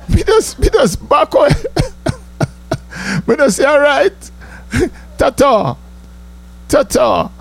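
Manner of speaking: high, laughing goodbye; laughing high exit. Retold or imitated. Comic backing away from his date, laughing and high.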